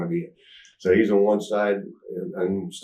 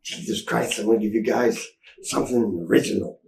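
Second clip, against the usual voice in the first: lightly voiced exasperated promoter; 3 semitones higher than usual. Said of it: "he was".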